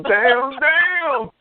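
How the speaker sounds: rising high cries of damn; overdone mock lament; mock wail. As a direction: ham up a grieving cry. Mock grief over Good Times.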